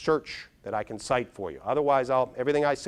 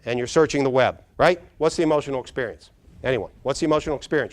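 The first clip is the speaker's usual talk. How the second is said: ordinary talk, nothing marked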